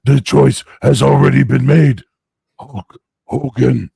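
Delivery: high, loud, slow Hulk Hogan growl of a wrestler; hammed-up wrestler boasting, drawn out; Hulk Hogan impression. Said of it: "keep calling people Hulk Hogan".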